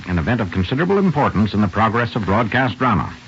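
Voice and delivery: ordinary talk, nothing marked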